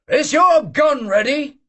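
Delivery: high, slow, loud shout; hearty shout. Acted. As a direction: shout as if to a crowd. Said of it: "he shouted".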